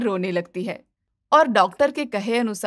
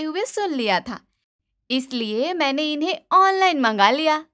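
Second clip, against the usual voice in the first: cheeky daughter-in-law; 3 semitones higher than usual. Acted. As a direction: gloat sweetly.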